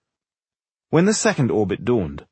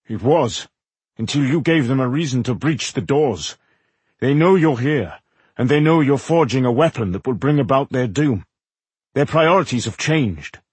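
grave warning with raised intensity; mildly heated, urgent explanation; 2 semitones higher, 3 dB louder than usual. A narrator's reading. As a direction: press the danger home, firmly. Character warns of imminent attack.

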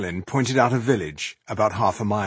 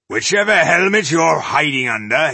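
loud, raised challenge to a hidden culprit; calling out; 6 semitones higher, 7 dB louder than usual. A narrator's reading. Dwarf addressing an unseen troublemaker, calling out. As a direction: throw it out to the whole column. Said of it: "he called out".

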